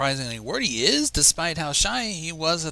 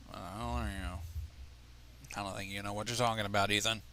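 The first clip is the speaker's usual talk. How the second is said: hushed doubt; 5 semitones lower, 8 dB quieter than usual. Acted. Quieter voiced retort to Ethan.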